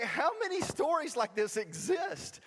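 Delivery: high, drawn-out incredulous question; reenacted bewildered protest; exasperated disbelief. Exasperated reply to parents.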